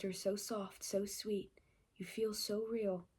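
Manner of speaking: low, very soft, near-whispered; hushed intimacy. Acted. Hero murmuring to his love, hushed intimacy. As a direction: breathe it low and quiet, like a lover. Reading a hero's romantic lines.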